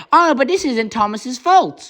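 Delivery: high, drawn-out mock objection; objecting fans. Quoting viewers who defend Thomas, mock objection. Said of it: "I know some people said".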